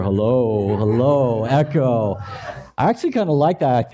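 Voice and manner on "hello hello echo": testing the echo, deep voice; hello into an echoey mic. Shown as a demonstration. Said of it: "I feel more authoritative there. Um, godly voice".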